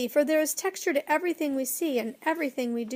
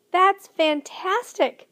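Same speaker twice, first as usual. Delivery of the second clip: high, loud and slow amazement; amazed; 8 semitones higher, 6 dB louder, much slower than usual. Acted. Lindy marvelling at the Whangdoodle's slippers, excitedly. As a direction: gasp it out, thrilled. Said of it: "Lindy drew in her breath".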